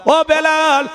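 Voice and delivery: high, imitated coaxing call. Preacher voicing Abu Jahl to Belal, calling out. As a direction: call the name high and carrying.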